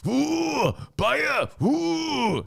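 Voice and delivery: high, drawn-out whooshing howls; hammed-up high "ooh" fire noises; imitated fire-breathing. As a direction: mimic a fire-breather blowing out flames.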